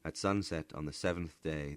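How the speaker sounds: ordinary delivery, nothing marked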